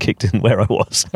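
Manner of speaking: normal talking voice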